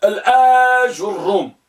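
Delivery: الآج الروم with long آ, then a light ju; light damma on jim. Shown as a demonstration. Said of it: "والجيم تكون مضمومة لكنها مخففة".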